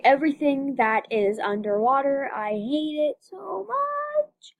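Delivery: drawn-out slow whine. Real, not acted. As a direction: stretch it out slowly. Drawn-out complaint.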